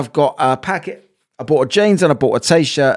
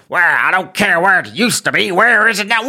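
put-on American accent. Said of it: "she was American".

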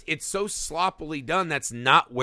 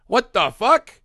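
higher and louder, fed up; what the fuck, incredulous and loud; exasperated outburst; 8 semitones higher, 7 dB louder, slower than usual. Real, not acted. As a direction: throw up your hands in disbelief.

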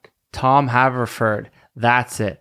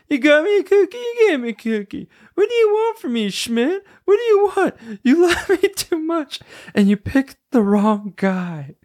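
imitating Nick. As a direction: squeal it like a fed-up Nick. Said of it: "That's Nick".

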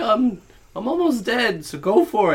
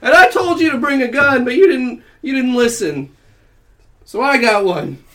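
loud challenge; 11 semitones higher, 11 dB louder than usual. Acted. Cowboy standoff, loud challenge.

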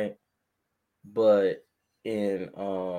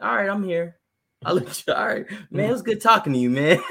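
relieved fake casual; 8 semitones higher, 4 dB louder, faster than usual.

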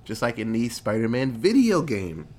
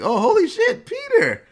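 much higher, loud startled cry; shrill-free shocked exclamation, pitched way up; startled gasp; 6 dB louder than usual. Retold or imitated. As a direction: yelp it like you've just spotted him. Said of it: "he's like".

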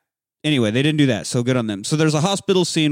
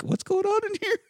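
very high, strained and softer voice; high-pitched, reined-in mock alarm; mock alarmed teacher; 6 dB quieter than usual. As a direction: imitate a colleague bursting in to check. Alarmed teachers opening the classroom door.

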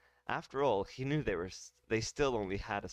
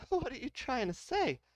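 pitched way up in disbelief; high, incredulous question. Acted.

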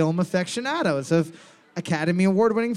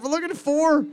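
drawn-out game-show announcement, higher; host announcer voice; 7 semitones higher, slower than usual. Acted. Quiz host announcing the answer to his co-host, drawn out.

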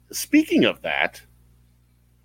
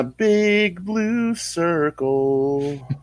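very slow and higher, each word stretched; slow drawn-out; 8 semitones higher, much slower than usual. Pretend. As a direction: draw every word out slowly. Repeating the phrase for effect.

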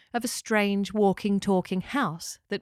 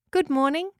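higher, rising greeting; friendly talking house; 7 semitones higher, 3 dB louder than usual. A narrator's reading. Locker greets the new arrivals, friendly house voice. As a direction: greet newcomers with an upward lilt.

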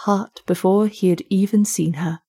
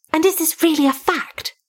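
a higher, inquisitive voice; lightly voiced curious gentleman; curious listener; 9 semitones higher than usual. Inquisitive gentleman questioning the storyteller, higher.

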